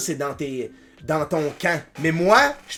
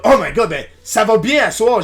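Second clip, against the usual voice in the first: acted excitement; 3 semitones higher, 7 dB louder than usual. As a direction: replay being thrilled at the numbers. Acts out past excitement over viewers.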